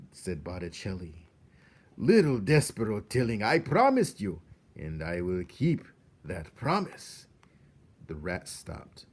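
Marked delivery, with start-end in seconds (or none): 2.0-7.3 louder, oily reassuring rat; mock-gentle rat. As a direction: reassure with a hint of menace. Botticelli reassures.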